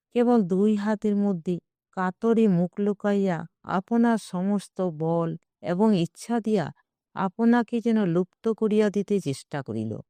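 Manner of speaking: ordinary delivery, nothing marked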